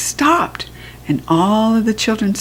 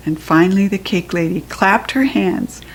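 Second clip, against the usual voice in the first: ordinary delivery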